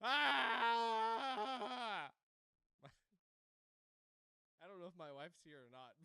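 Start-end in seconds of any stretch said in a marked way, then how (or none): 0.0-2.1 wavering high scream; long mock bloody-murder scream; game scream. Player testing the scream control, wavering scream. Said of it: "I'm screaming bloody murder".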